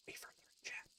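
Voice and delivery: breathy whisper to chat. Real, not acted. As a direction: lean in and whisper it. Stream aside to chat.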